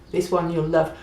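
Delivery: normal talking voice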